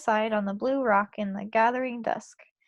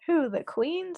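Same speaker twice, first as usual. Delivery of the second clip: shocked cry, pitched up high; aghast; 6 semitones higher, slower than usual. A narrator's reading. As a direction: gasp it out in disbelief. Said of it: "cried, aghast".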